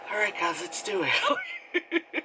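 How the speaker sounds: very high, very slow giggly chirp; eerie vlogger voice. Retold or imitated. Girl starting the challenge for her viewers, high and slow with a giggle. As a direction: stretch it out like a spooked-up cheer.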